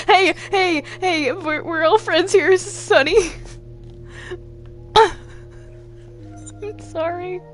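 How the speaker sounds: ordinary delivery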